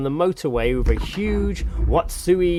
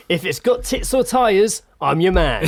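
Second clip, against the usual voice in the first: mock boastful bloke, slow and higher; passing chap on his phone; 5 semitones higher, 4 dB louder than usual. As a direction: imitate a passer-by bragging down his mobile. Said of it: "a chap walking past the house on his mobile".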